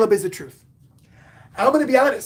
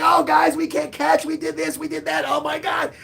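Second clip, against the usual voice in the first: mock exasperated fan; 5 semitones higher than usual. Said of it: "He's like".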